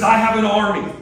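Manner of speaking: loud, high menacing villain line. Retold or imitated. Preacher voicing Loki's threat, loud and menacing. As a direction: boom it out like the villain Loki. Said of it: "Loki, the villain, says".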